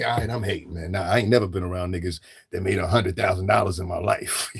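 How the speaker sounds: normal talking voice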